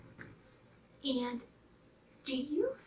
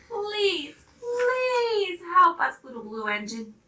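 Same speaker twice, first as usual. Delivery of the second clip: louder, pleading; begging dolls; 4 semitones higher, 6 dB louder than usual. Acted. Dolls begging the little blue engine, loud pleading. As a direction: beg her, rising on please.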